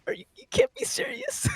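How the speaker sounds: shrill disbelieving yell; outraged shout. Retold or imitated. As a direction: shriek it in disbelief. Friend's outraged reaction retold.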